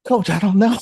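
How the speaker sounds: a hint of a helpless client answering; imitated client. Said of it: "And they say".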